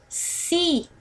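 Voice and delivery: "si" held long and set apart; stronger SI. Shown as a demonstration. Teacher shows which syllable we say stronger, stressed "si". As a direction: lean on "si" and draw it out. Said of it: "O si nós falamos mais forte".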